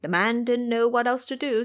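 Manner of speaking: ordinary talk, nothing marked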